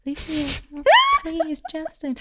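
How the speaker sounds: soft, timid pleading as a bashful fan; shy girl.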